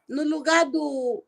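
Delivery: ordinary talk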